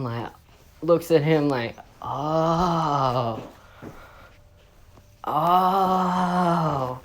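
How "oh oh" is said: long drawn-out "oh"; dawning realisation. Retold or imitated. Student finally getting the teacher's point, dawning realisation. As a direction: let the penny drop slowly, acted.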